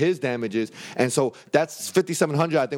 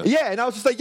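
normal talking voice

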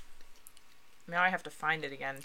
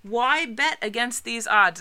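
slow, higher, louder poster slogan; dramatic slogan read; 5 semitones higher, 9 dB louder, slower than usual. Shown as a demonstration. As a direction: read it like a wartime warning ad. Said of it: "number two".

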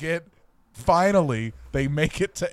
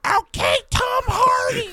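Andy Serkis impression; 3 dB louder than usual. Director addressing Tom Hardy on set, squealed impression. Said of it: "quick impression of Andy Serkis".

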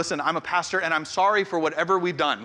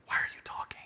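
whispered aside; 13 dB quieter, faster than usual. Retold or imitated. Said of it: "I was like".

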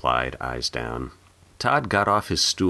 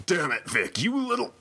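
angry outburst. Acted. Angry father lashing out at son.

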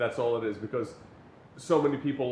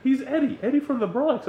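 much higher, exasperated retold insistence; mock insistence; 11 semitones higher than usual. Stranger arguing about his friend Eddie, mock insistent. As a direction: insist as if it were obvious.

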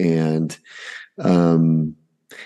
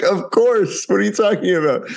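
much higher, playful disbelief; mock incredulous; 13 semitones higher than usual. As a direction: act amazed they even asked. Dad retelling his amazed reply.

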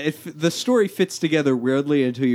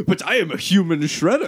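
higher, emphatic mock declaration; imitated show character; 5 semitones higher than usual. Recapping his boast about paperwork, lightly mocked declaration. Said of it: "He's like".

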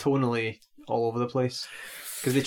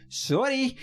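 Scotty; 12 semitones higher than usual. Retold or imitated. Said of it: "Scotty just says".

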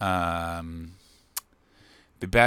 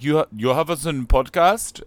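imitating Baltic accent; 4 semitones higher, 8 dB louder than usual. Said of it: "People were like".

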